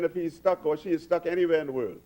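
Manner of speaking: normal talking voice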